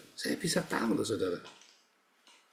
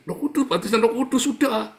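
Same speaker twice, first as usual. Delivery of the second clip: much higher, laughing mock of the Holy Spirit talk; 10 semitones higher, 5 dB louder than usual. Preacher mocking charismatics to his congregation, high laughing mockery.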